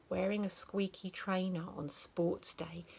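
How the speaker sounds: plain reading voice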